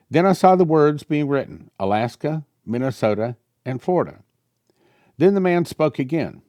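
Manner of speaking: lightly heightened prophetic reading; reading prophecy aloud. Acted. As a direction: read the vision out like a revelation. Reads prophecy.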